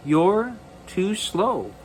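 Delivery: lightly voiced cross little boy, slow and whiny; grumpy boy to dog. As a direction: drag it out, annoyed at the slow dog. Angus grumbling at his dachshund.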